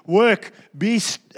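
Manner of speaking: ordinary talk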